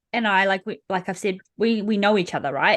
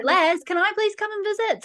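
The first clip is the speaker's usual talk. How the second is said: much higher, small pleading voice; timid listener asking; 11 semitones higher than usual. Retold or imitated. Host voices a shy listener asking to come on, small high plea.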